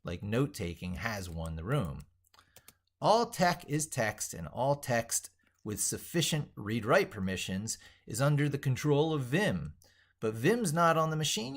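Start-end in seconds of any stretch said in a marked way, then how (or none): 3.0-11.5 grandiose proclamation. Acted. Grandiose manifesto read for video.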